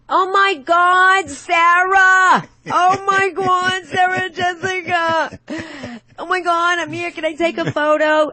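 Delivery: high, loud, stretched "oh my God, Sarah"; excited fan shriek. Retold or imitated. Fan who spots the star on the street, shrieking with excitement. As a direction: squeal at the star like a starstruck fan. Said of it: "she's like".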